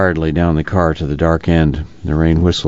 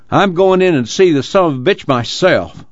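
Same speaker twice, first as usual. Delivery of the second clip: angry voice; 11 semitones higher than usual. A narrator's reading. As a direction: shout it, raging at the man inside. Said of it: "the sound of an angry voice".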